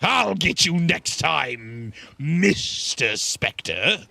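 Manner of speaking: slow, drawn-out villain taunt; villain's threat. Acted. Streamer playfully threatening Mister Spectre, drawn-out villain voice. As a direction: stretch the threat out like a storybook villain.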